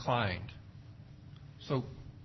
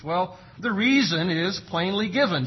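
normal talking voice

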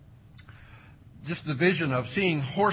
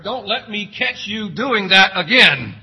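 loud stern parent threat; mock parent warning; 5 semitones higher, 10 dB louder than usual. Preacher voices a parent's warning, loud and stern. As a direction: warn like an exasperated dad.

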